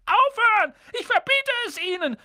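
very high, frantic yell; desperate high-pitched shouting; panicked scream. A narrator's reading. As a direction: shriek the order in panic. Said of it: "schrie Onkel Vernon in Panik".

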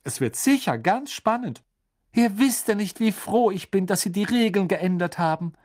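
high-pitched voice with a smile; smiling mother. A narrator's reading. Misses Weasley keeping the secret from her children, smiling.

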